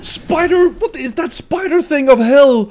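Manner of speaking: high startled spider scream; startled shriek. Real, not acted.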